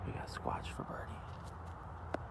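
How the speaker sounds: hushed golf commentator. Pretend. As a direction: whisper it like televised golf. Hushed golf broadcast call.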